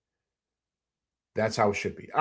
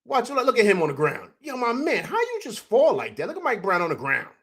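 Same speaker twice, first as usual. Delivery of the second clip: ordinary talk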